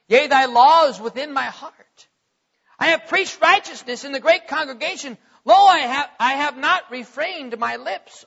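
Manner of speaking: loud, surging psalm reading; impassioned scripture reading. Acted. Preacher reading Psalm forty to the church, impassioned. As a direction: declaim it like a prayer of your own.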